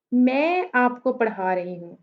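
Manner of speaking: मैं drawn out and prominent, rest unaccented; stress on मैं. Shown as a demonstration. Teacher shows students who is teaching, stress on मैं. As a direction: lean on the word मैं at the start. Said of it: "मैं stress कर रही हूँ मैं पे".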